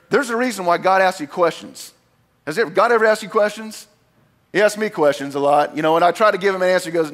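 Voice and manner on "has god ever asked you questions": ordinary talk, nothing marked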